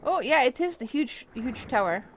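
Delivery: usual speaking voice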